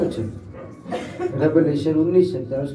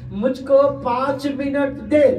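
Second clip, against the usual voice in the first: high, slow, drawn-out demand; raised-pitch pulpit delivery, slow and forceful; emphatic preached quote; 8 semitones higher, slower than usual. Retold or imitated. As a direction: preach the request out, stretching each word. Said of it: "क्या बोलता है?".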